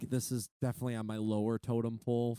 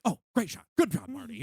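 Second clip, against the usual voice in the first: higher, delighted pat on one's own back; mock self-praise; 7 semitones higher than usual. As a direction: imitate Scorsese cheering himself on. Mimicking Scorsese praising his photos.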